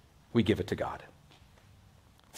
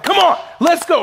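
much higher, louder shout of a fired-up coach; college coach yell; 11 semitones higher, 5 dB louder than usual. Retold or imitated. Coach of the other girls' team hyping the players, shouting. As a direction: bark it like a courtside basketball coach. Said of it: "they're like".

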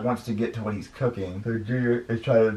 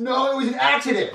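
high, defensive character voice; pleading kid character; 3 dB louder than usual. Acted. Puppet Junior excuses himself to the chef, pleading. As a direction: protest it in a high voice.

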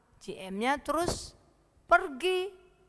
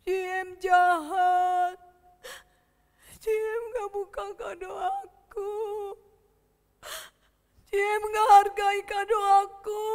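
put-on high, wailing child's bawl; hammed-up crying; 7 semitones higher than usual. Boy complains grandma ignored his gift, wailing. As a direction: sob out each complaint, stretching the endings. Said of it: "si Boy nangis".

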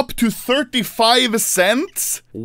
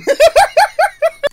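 squealing burst of laughter; high squealing laugh; 5 dB louder than usual. Real, not acted. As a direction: shriek with laughter. Laughs at pain joke.

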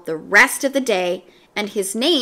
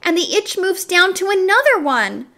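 put-on higher, louder, quick exasperated kid; frantic schoolgirl; 7 semitones higher, 3 dB louder than usual. A narrator's reading. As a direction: rattle off the itching in frustration. Dana explains her itching.

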